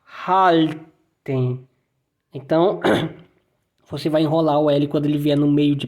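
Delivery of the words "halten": "Halten" slow, L curled as in Spanish; rolled German L. Shown as a demonstration. Teacher repeating the example for learners, rolled L. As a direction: draw out the L in "Halten", tongue up. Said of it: "como se estivesse falando espanhol".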